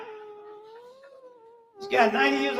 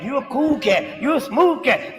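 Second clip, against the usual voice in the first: normal talking voice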